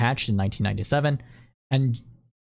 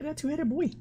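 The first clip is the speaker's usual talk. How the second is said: much higher and softer, cooing at the picture; cooing baby talk; 13 semitones higher, 7 dB quieter than usual. Acted. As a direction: gush softly over the little guy. Cooing over frog picture again.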